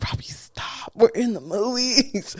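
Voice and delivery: hushed whisper scolding; mother shushing son, whispered. Retold or imitated. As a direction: whisper it in a crowded cinema. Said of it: "I'm like".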